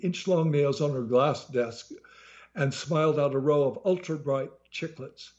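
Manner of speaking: plain reading voice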